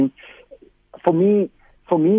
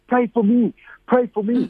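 high, eager pleading; pleading elder; 9 semitones higher than usual. Retold or imitated. Village elder begging the preacher for prayer, eager and high. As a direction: beg for prayer, pitched well up. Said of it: "he's like".

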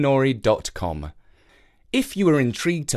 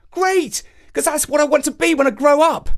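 put-on high excited boy voice; thrilled little boy; 14 semitones higher, 5 dB louder than usual. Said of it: "replied Jake".

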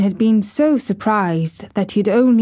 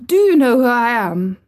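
haughty demand, pitched up; 4 semitones higher, 4 dB louder than usual. A narrator's reading. Young rajah confronts the staring gardener, demanding. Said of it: "demanded the Rajah".

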